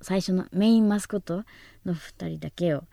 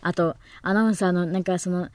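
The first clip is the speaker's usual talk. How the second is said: ordinary delivery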